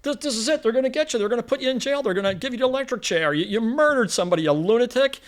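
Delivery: high, frantic rush of warnings. Retold or imitated. Yossarian warns the killer as police climb the stairs, high and frantic. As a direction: panic at him that the police are coming. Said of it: "and he goes".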